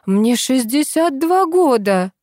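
high, slow, loud indignant exclamation; exclaiming. A narrator's reading. Lady pleading her sixty-two years, indignant exclamation. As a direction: protest each word emphatically. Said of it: "воскликнула дама".

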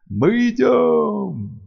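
high, slow otherworldly chant; booming spirit voice. Acted. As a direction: call out like ancient spirits. Spirits announce their arrival.

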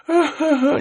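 high, feeble ghostly giggle; weak, pained laugh. Retold or imitated. Storyteller imitates the hanged ghost, weak pained laugh. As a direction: imitate a faint laugh full of pain. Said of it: "cười rất là nhẹ".

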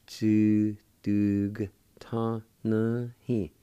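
Tudugtanahi slowly, each syllable held apart; slow teaching repeat of Tudugtanahi, G ending DUG; syllable-by-syllable pronunciation. Shown as a demonstration. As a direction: sound out Tudugtanahi with small gaps between syllables. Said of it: "like this".

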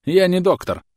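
high, loud, happy exclamation; gleeful retort. A narrator's reading. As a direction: toss it off with glee. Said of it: "радостно ответил француз".

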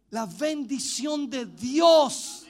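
"La bendición de Dios" very slow, word by word; énfasis alargado. Real, not acted. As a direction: stretch out "La bendición de Dios", weight on "Dios". Preacher stresses the key phrase. ("La bendición de Dios" lento y con énfasis)

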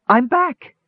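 very high, elated exclamation; high-pitched burst of joy; joyful cry. A narrator's reading. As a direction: cry out with overjoyed disbelief. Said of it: "he cried out joyfully".

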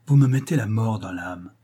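ordinary delivery, nothing marked

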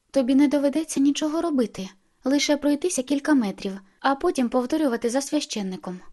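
lifted, insistent sales pitch; eager persuading. A narrator's reading. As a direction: talk her into it eagerly. Alice eagerly persuades Bella in story.

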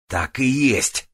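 high, slow, loud bark. Acted. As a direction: bark it out triumphantly. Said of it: "рявкнул Грист".

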